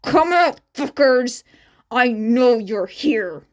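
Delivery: loud raging call to hidden people; furious shout. Acted. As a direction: bellow it across the room. Bakugo hunting hidden classmates.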